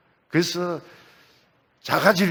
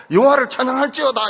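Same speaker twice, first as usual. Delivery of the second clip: acted joyful praise; 10 semitones higher, 6 dB louder than usual. Acting out mother-in-law's joyful praise.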